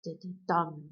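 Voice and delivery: weak-weak-strong pulse, "da da DUM"; anapestic stress. Shown as a demonstration. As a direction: save the punch for the final "dum". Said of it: "emphasis on the last syllable".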